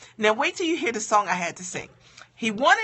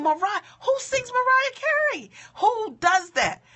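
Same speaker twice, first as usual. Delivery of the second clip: incredulous; 11 semitones higher than usual. Real, not acted. Mocking her own song choice.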